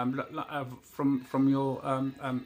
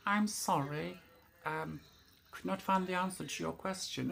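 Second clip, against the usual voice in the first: lightly voiced phone assistant, higher; tinny robot voice; 4 semitones higher, 5 dB quieter than usual. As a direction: answer as the prim voice assistant. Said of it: "he went".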